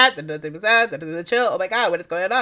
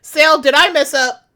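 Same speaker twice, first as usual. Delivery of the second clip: ordinary delivery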